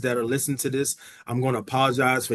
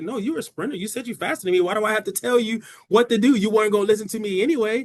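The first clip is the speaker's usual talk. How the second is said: sisterly scolding; 7 semitones higher, 4 dB louder than usual. Retold or imitated. Sister scolds losing sibling.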